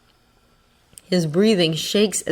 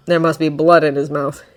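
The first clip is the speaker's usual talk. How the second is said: ordinary delivery, nothing marked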